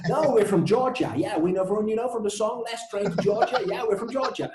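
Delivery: high-pitched put-on Georgia accent; hammed-up attempt at a Georgian accent; fake Georgian answer. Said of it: "that's a Georgian accent?".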